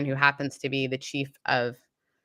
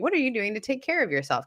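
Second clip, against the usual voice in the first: a light hint of Kevin asking gently, pitched higher; Kevin's voice; 7 semitones higher than usual. Friend imagines Kevin's caring question, lightly voiced. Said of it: "hear Kevin's voice going".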